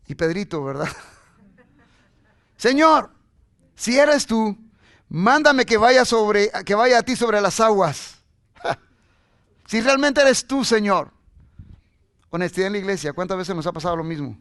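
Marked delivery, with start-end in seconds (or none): 2.6-8.2 loud, high, drawn-out call. Acted. Peter calls to Jesus from the boat, loud drawn-out call. 9.7-11.1 loud, raised challenge; calling out. Acted. Peter asks Jesus to prove it is him, calling out.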